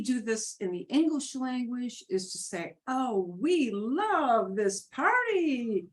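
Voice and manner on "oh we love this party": "love" and "party" drawn out in big swoops of pitch; slow, gushing rise and fall on "love this party"; emphatic inflection. Shown as a demonstration. Teacher showing students English emphasis by voice, emphatic inflection. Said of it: "we do inflection of the voice".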